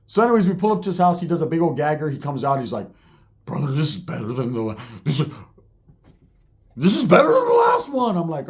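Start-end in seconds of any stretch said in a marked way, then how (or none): 3.5-5.3 imitating talk through a held hit; choked by smoke. Roach struggling to speak after a hit. 6.8-8.2 imitating an amazed, high-pitched yell; thrilled outburst. Roach exclaims after a big hit.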